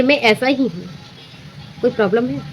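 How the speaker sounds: casually accepting, unbothered; easy agreeable tone. Shown as a demonstration. To someone mocking your flaw, easy unbothered agreement. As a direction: shrug it off lightly as if agreeing. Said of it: "सहमति मन से बोलिए".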